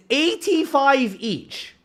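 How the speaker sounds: high, slow, mock posh British. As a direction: put on a snooty British accent for the price. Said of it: "Me when I'm fucking British".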